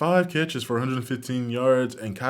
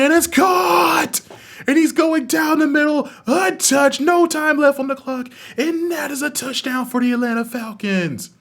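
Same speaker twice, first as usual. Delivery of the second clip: much higher and louder, shouting the play; excited touchdown call; 13 semitones higher, 8 dB louder than usual. Acted. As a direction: yell it like the winning score just happened. Last-second game-winning touchdown call.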